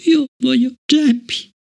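high, slow, a touch tremulous; a tearful child's plea; trembling child. Acted. Tear-stained Fallon to Bliss, slow tremulous child voice. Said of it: "con voce tremula".

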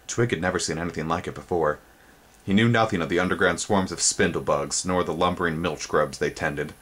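usual speaking voice

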